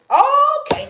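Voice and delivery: high, loud laugh through the word; okay burst out while laughing. Real, not acted. Laughs at her own remark.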